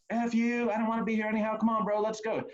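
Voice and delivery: put-on high, belligerent drunk voice; mock drunk belligerence; drunk best man. Best man leaving wedding.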